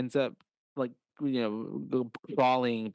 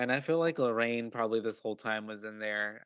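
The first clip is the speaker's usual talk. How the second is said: usual speaking voice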